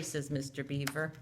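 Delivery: ordinary delivery, nothing marked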